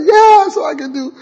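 high, loud cry of release; mock shout of freedom. Teen off to boarding school, whooping I'm free. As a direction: yell it like a kid let loose.

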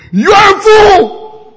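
high, loud, slow yell of contempt; mock shouted insult. Online poster yelling at the president, slow loud insult. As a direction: bellow the insult slowly.

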